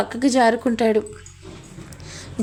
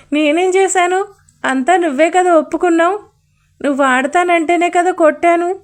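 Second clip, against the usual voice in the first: defensive protest; 5 semitones higher than usual. Acted. Kaveri defends herself, scolded.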